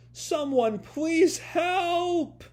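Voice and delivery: high, drawn-out cry for help; acted yell. Tokyo breaks down and begs for rescue, desperate acted yell. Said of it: "and then he just yells".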